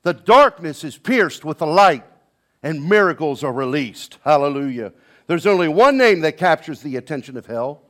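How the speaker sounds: loud, ringing sermon voice; preaching at full force. Acted. Preacher rousing the congregation, preaching at full force. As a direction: preach it out to the whole church.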